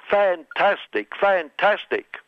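hammed-up Trump gushing; mock Trump fantastic. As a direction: savour each fantastic like a showman president.

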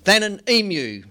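lively rhythmic poem reading; poem performance. Acted. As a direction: perform the list poem with punch.

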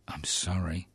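whispered mock apology, voiceless. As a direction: breathe a sly little I'm sorry.

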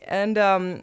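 ordinary talk, nothing marked

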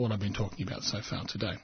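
usual speaking voice

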